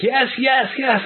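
retold fanboy squeal of yes; eager yes. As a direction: bounce it up high like a thrilled fan. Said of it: "and I was like".

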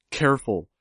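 loud one-word warning; narrated shout. A narrator's reading. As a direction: call out sharply to warn an ally. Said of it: "I shouted".

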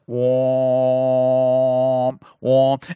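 long low droning trumpet "womp"; imitated trumpet blast. Imitating the end-times trumpets on the video, low and droning. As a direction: hold a low horn note. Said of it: "these trumpets".

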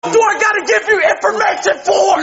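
angry shouting. Real, not acted. Arrested man yelling at officer.

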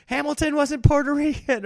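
hammed-up pedantic moviegoer; mock know-it-all heckler. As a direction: pretend heckler correcting the film, high mock voice. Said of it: "Are you out there just saying".

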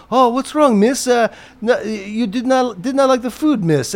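high, mock-concerned questioning; high-pitched, put-on solicitous voice; Sammy. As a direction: imitate an owner needling an old lady. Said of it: "And, and Sammy goes".

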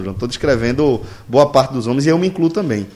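ordinary delivery

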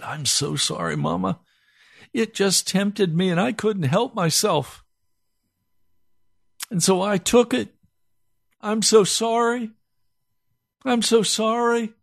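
put-on high, crying little boy begging forgiveness; tearful pleading. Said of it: "and I began to cry".

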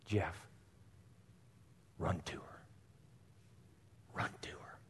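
whispering Run to her; hushed whisper. Retold or imitated. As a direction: breathe it gently. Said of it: "in Sarah's voice said this".

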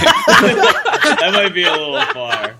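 amused, half-laughing protest. Real, not acted. Laughing at the governor suggestion.

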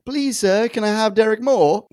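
high-pitched mock plea; pleading beggar. Cohost begging for extra Derek, mock pleading. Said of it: "you're always begging a".

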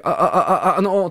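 high, laughing stammer; deliberate stutter. Shown as a demonstration. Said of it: "とか".

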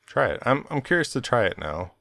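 ordinary talk, nothing marked

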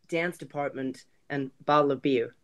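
half-hearted American accent; softly tried-out Ohio American demo. Shown as a demonstration. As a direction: attempt a few Ohio-accented words. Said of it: "I can't do Ohio, other than to say".

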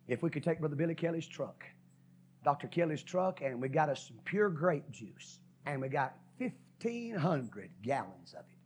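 normal talking voice

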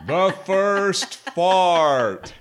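slow, high, drawn-out announcer. Pretend. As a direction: boom it out like a show title. Drawn-out announcing of segment title.

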